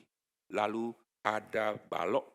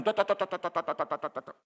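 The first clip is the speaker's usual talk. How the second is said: rapid tat-tat chatter, higher; mock chatter noise; 8 semitones higher, 3 dB louder, faster than usual. Preacher mimics the thieves' chatter, rapid tat-tat. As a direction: rattle it off like noisy chatter.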